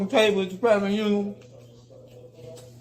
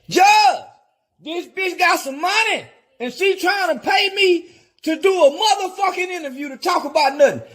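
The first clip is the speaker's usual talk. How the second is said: mock shout to the judge; 8 semitones higher than usual. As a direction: yell it up to the bench.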